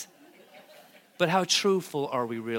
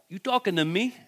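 put-on light Italian-American tough-guy accent, higher; a playful Italian-American De Niro-style challenge; 5 semitones higher than usual. Pastor doing Isaiah as an Italian-American tough guy, joking. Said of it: "You think he's Italian".

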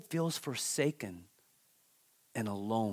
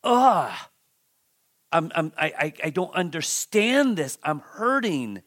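pained exasperation; 5 dB louder than usual. Acted. Preacher acts out Job hurting under clinging sores, in pained exasperation. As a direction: voice Job's bewildered hurt, opening on a loud groan. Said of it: "And, and he's just".

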